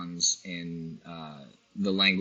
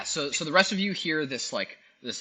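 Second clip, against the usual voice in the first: usual speaking voice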